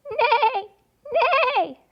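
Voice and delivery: high whinnying neigh; horse neigh. Acted. Reading to kids, a high whinnying neigh. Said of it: "says the horse".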